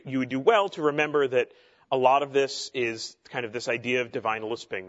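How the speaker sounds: ordinary delivery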